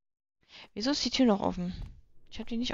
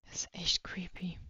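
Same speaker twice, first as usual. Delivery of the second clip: whispering; 9 dB quieter, slower than usual. Real, not acted. Creepy game scene, whispering.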